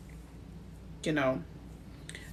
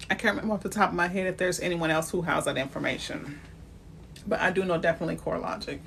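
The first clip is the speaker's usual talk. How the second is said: normal talking voice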